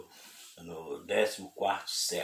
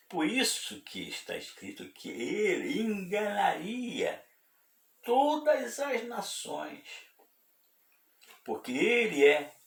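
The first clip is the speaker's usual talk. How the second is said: impassioned preaching; 13 semitones higher, 3 dB louder than usual. Real, not acted. Preacher warns that all nations are deceived, high impassioned preaching. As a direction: proclaim it high, stretching the key words.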